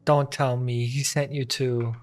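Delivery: ordinary delivery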